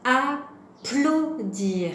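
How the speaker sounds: Applaudir very slow, A, PLAU, DIR with pauses between; split into syllables. Shown as a demonstration. Teacher helps children hear the P, Applaudir split by syllable. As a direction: break Applaudir into separate beats, stretched out. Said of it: "c'est moins facile à entendre".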